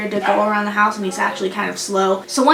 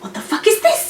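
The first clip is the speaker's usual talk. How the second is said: high, rising astonished question; mock shock; 9 semitones higher than usual. Intruder finding a captive, mock shocked. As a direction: lightly act out a startled discovery. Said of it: "And then Yoon Bum's like".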